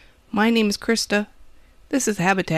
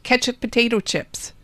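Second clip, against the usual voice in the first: usual speaking voice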